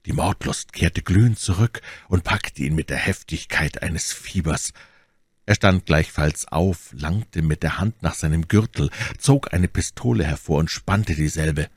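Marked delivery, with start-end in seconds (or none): none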